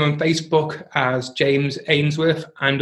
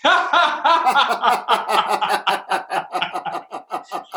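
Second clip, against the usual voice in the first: laughter exercise laugh; 4 semitones higher than usual. Shown as a demonstration. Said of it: "So we're gonna go".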